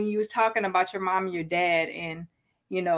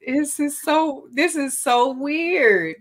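slow amazed; 6 semitones higher, slower than usual. Real, not acted. Guest's dream coincidence.

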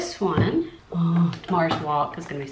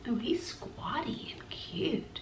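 whisper; 6 semitones higher, 9 dB quieter, slower than usual. Real, not acted. Cooing at a cute marker.

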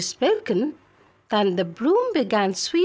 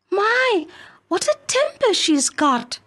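put-on much higher, bright girl's voice; eager young maid; 8 semitones higher than usual. Filippipa character voice.

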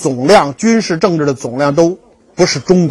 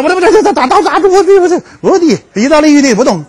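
high, stammering panic; mock helpless stammer, voice jumping up; flustered stammer; 9 semitones higher, faster than usual. Lecturer imitates himself stuck among fast Italian, flustered. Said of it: "我的个天呐".

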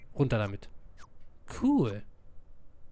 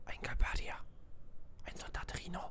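very soft unvoiced whisper; 12 dB quieter than usual. Player voicing a hiding squad member, whispering. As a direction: hush it, the soldiers are close. Sneaking past enemy soldiers.

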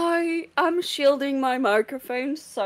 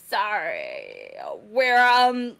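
ordinary talk

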